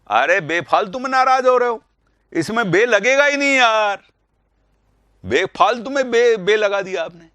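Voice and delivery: high, loud mock telling-off of the class; playful mock scolding. Teacher to students who fell for the trick, mock scolding. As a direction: tease them for getting worked up over nothing.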